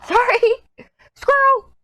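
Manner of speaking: high, squeaky burst of amusement; real laughing, high-pitched yelp; giggly distracted outburst. Real, not acted. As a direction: blurt it out high and loud through a laugh. Distracted mid-sentence, laughing at herself.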